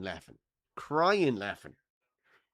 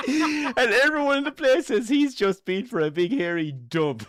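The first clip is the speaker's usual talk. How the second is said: laughing through it; 10 semitones higher, 6 dB louder than usual. Real, not acted.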